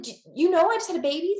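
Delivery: high, loud and fast, stunned; can't believe they said it; incredulous reply. Retold or imitated. Acted reply to weight comments.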